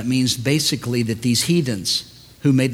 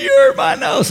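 high, loud wail of protest, acted; hammed-up child's shriek over a tiny hurt; whiny daughter; 7 dB louder than usual. Daughter overreacts to brushed nose, whiny mock wail.

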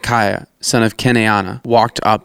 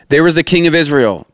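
higher-pitched, restrained narrated shout; 6 semitones higher than usual. A narrator's reading. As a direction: call out the sighting without full volume. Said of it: "they shouted".

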